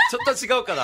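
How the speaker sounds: high laugh. Real, not acted. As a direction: crack up. Laughs at own attempt.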